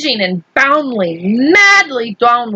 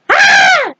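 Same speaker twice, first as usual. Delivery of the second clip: high, loud scream of fright; 4 dB louder than usual. Acted. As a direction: shriek like falling in the dark. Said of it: "There were screams".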